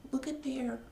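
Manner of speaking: whispered aside. A narrator's reading. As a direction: whisper it to the kids. Aside to listening children.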